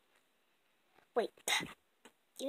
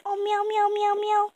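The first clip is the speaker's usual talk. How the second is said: higher meowing voice; cat meows; 7 semitones higher than usual. Acted. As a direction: meow back like a kitty.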